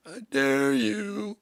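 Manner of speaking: high, slow, stretched-out taunt; taunting dare. Acted. Singer challenging his band, taunting. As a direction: dare them in a drawn-out high voice.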